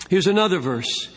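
ordinary talk

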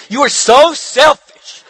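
mock the wife's reproach playfully; mock accusing wife. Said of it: "would she say".

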